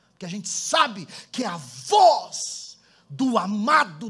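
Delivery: loud, ringing preacher's climax; preaching at full force. Acted. Pastor preaching to the church, full-force proclamation. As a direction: build up and proclaim it to the room.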